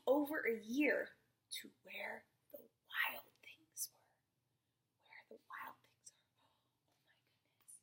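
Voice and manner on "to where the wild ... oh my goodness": slow, soft whispered title; hushed storytime whisper. Acted. Teacher reaching the wild things page, slow whisper.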